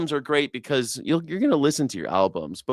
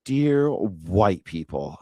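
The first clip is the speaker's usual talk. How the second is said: very slow, drawn-out mock address; imitating the creator's earnest tone; mocking preachy tone; 5 semitones lower, much slower than usual. Shown as a demonstration. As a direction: stretch it out like a sanctimonious lecture. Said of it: "just the tone of voice".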